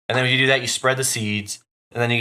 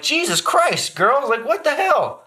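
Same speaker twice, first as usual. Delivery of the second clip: very high, slow exasperated scold; high slow mock exasperation; slower than usual. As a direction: whine at the girls in disbelief. Scolding kids over mess.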